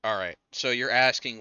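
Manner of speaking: normal talking voice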